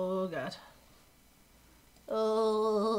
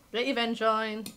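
usual speaking voice

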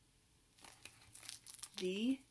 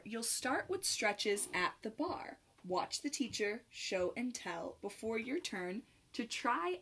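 lively, sing-song picture-book read; bouncy rhyming storybook voice; reading to children; slower than usual. Acted. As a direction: read it like storytime for little dancers. Reads picture book to kids.

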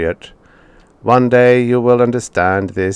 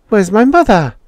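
put-on high, louder boy's voice asking; anxious boy; 13 semitones higher, 7 dB louder than usual. A narrator's reading. Boy asking the robot for his parents, high and worried. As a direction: plead like a worried child.

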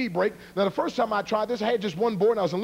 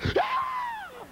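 long high-pitched mock scream; mad mom's yell. Your mom when she gets mad, imitation yell. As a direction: screech like an angry mother, hammed-up. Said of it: "your mom when she gets mad".

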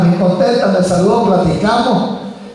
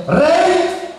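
sharply higher call of "Rey"; pushy accusers; 13 semitones higher than usual. Acted. Accusers pressing the king in a much higher insistent voice.

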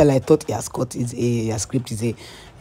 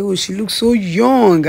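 higher, louder and slow, in awe; amazed; 6 semitones higher, 4 dB louder, slower than usual. Retold or imitated. Girlfriend meeting his mom, amazed and slow. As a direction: marvel at how young she looks. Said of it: "I was like".

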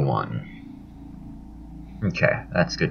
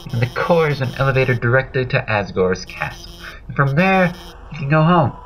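higher-pitched, eager explainer voice; eager game character; 9 semitones higher than usual. Acted. Helper explaining the core to the player, eager high voice.

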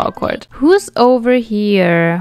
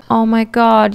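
ordinary delivery

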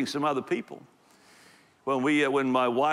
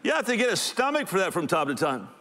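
ordinary delivery

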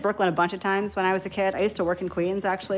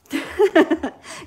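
loud, high burst of giggles; real laugh; 9 semitones higher, 7 dB louder than usual. Real, not acted. Amused at own accents.

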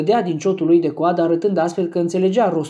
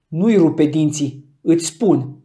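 slow, louder Nu-i rupe dinții with heavy stress on nui; more menacing; 4 dB louder, slower than usual. A narrator's reading. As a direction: spell the threat out slowly. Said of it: "pe un ton mai amenințător decât înainte".

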